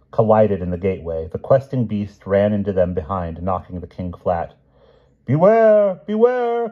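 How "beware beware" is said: high, loud, drawn-out cry of alarm; cried warning. A narrator's reading. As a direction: call it out shrilly like a crowd of retainers raising the alarm. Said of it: "cried all the retainers".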